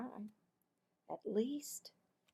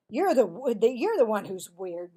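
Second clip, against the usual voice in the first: loud protest; defensive boy; 14 dB louder than usual. Acted. Marvin to Cassie, loud defensive denial. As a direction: deny it hotly.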